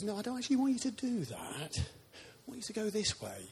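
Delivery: high-pitched, gently correcting voice of God; God speaking. Acted. God turning down the preacher's own plan, high and gently insistent. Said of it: "but God says".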